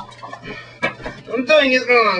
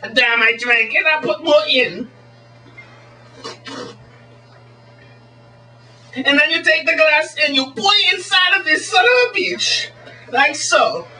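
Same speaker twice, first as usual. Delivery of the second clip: high, hammed-up mock Swedish showman; Swedish magician; 4 semitones higher than usual. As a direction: play a cheesy foreign stage magician, pitched up. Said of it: "as the Swedish magician".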